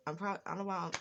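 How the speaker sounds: usual speaking voice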